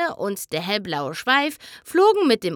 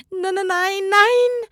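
panicked denial; 10 semitones higher than usual. Acted. Trixie disbelieving on stage.

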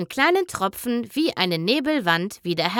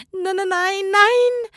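rising higher and louder with each nein; panicked denial; 10 semitones higher, 3 dB louder, slower than usual. Acted. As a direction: Trixie can't believe it, building panic. Trixie denies in panic. (panisches Nein, immer höher und lauter)

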